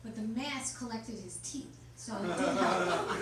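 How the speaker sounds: normal talking voice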